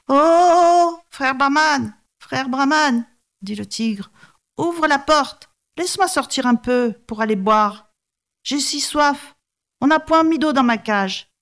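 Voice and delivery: high, wheedling tiger voice, begging to be let out; a caged tiger whining for water; pleading tiger. Acted. Tiger begs from his cage. (tigre suppliant, voix aiguë)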